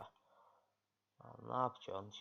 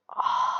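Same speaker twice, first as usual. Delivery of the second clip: loud, sudden "ah!"; one loud burst of exasperation; frustrated outburst; 17 dB louder than usual. Real, not acted. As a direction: cry out in frustration. Cries out during the game.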